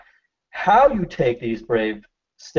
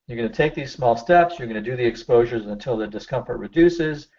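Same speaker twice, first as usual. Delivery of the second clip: usual speaking voice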